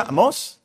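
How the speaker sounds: "Amós" with stress on the final ó; "Amós", last syllable stressed and rising; accent on the O. Shown as a demonstration. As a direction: land firmly on the ó of "Amós". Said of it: "acento en la O".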